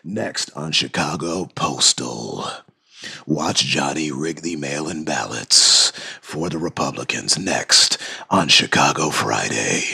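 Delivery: very low, slow announcer; hammed-up deep trailer voice teasing the next episode; TV promo voice. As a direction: intone it like a dramatic TV promo. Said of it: "I could do that with this voice".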